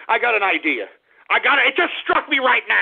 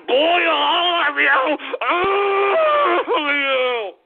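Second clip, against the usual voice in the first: slow sobbing yell; crying shout; 4 semitones higher, much slower than usual. Acted. Host wailing at all his listeners, sobbing. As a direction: wail at everyone.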